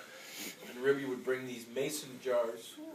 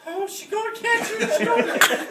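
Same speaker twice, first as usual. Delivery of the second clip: put-on child excited; 9 dB louder than usual. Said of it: "then I started thinking".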